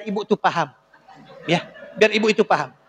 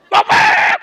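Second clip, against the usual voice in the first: much higher, loud angry yell of the name; louder call; 10 semitones higher, 6 dB louder than usual. Acted. Boss yells for the servant a third time, louder yell. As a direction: bellow it, losing patience. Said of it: "Lebih kencang".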